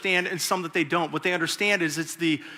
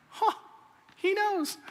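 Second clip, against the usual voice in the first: sudden high, soft realization; a private "aha" as you're led in; pleased surprise; 5 dB quieter, slower than usual. Retold or imitated. Thinking he gets big table.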